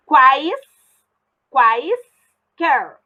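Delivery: "Quais", pause, "quaisquer" split into quais... quer; long gaps between syllables of "quaisquer". Shown as a demonstration. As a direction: slowly demonstrate the break in "quaisquer". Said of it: "Agora eu vou separar".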